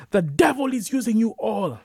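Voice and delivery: high, loud denunciation; accusing shout. A narrator's reading. Preacher boy accusing Isiaku with his Bible, high loud shout. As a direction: denounce them at full voice.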